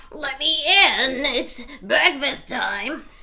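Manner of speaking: high, loud, slow put-on girl voice; wolf squeaking like a little girl; wolf faking girl voice. Wolf pretending to be Little Red.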